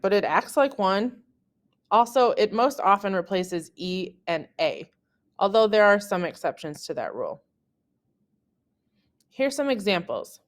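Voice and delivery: ordinary talk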